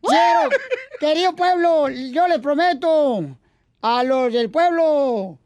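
lightly pompous campaign orator; mock politician rallying the crowd; booming balcony speech. Mock candidate speech, Poncho Corra. (discurso de candidato pomposo)

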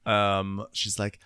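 ordinary delivery

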